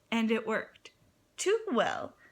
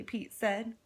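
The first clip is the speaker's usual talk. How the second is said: usual speaking voice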